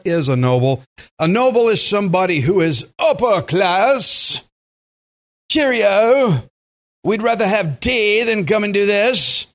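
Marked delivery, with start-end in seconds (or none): 3.0-4.4 high, hammed-up upper-class British voice; British accent. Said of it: "speaking with a British accent". 5.5-9.5 high, mock upper-class British nobles; British accent. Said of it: "speaking with a British accent".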